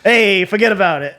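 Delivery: mock New York Italian. Joking Italian catchphrases.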